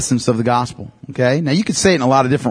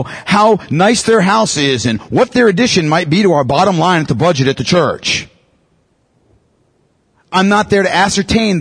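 louder, pressing preacher's cadence; fervent preaching; 5 semitones higher, 3 dB louder than usual. Acted. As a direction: drive the point home to the congregation. Preaches with rising force.

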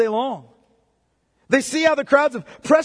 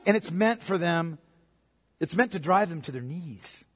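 normal talking voice

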